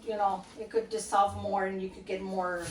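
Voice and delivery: ordinary delivery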